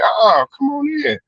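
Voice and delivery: high, sing-song imitation of a hostess; lightly voiced woman inviting you in; mock Southern hospitality; welcoming southern woman. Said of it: "they like".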